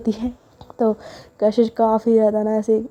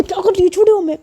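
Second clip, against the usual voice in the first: higher, louder startled protest; shocked outcry; 9 semitones higher, 4 dB louder, faster than usual. Acted. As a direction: recoil as sweets hit your clothes. Clothes smeared, shocked protest. (कपड़े गंदे होने पर चौंककर ऊँची चीख)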